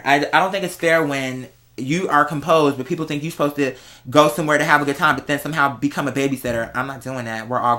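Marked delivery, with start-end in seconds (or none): none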